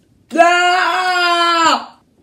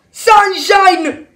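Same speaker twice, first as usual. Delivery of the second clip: loud, raised-pitch call; urgent shout; 5 semitones higher, 4 dB louder than usual. Acted. Nick calls Sunshine away from her daughter, urgent shout. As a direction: yell the name to get through.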